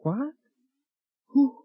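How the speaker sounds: high-pitched, startled questions; stunned questions. Acted. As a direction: blurt it in high disbelief. Shocked by her engagement ring.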